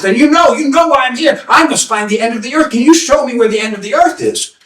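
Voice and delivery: put-on high, pleading young seeker; eager young man. Young man begging the seer for directions, high and earnest. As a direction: ask earnestly for the way.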